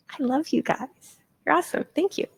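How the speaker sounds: high, soft, affectionate thanks; softly delighted, pitched well up; gushing thanks. Real, not acted. Gushing thanks after show of hands.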